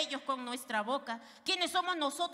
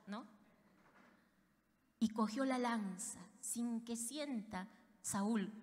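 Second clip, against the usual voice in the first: ordinary talk, nothing marked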